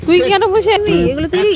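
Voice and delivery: loud, high call across the room. Real, not acted. Calling out loudly to someone sitting idle. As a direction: raise the voice to reach someone away.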